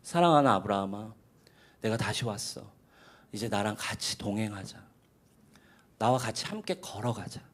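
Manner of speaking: God inviting Abraham, hushed and tender; tender invitation. Retold or imitated. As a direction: coax him gently, like a loving father. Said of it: "초대하시는 거예요".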